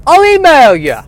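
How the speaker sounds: high, loud Irish paramedic voice; brash Irish paramedic; Irish accent. Acted. Phil parting shout.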